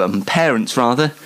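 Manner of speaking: ordinary delivery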